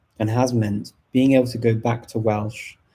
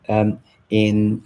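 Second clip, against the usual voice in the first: ordinary talk, nothing marked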